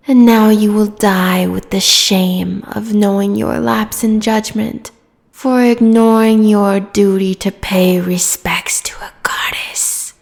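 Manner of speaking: usual speaking voice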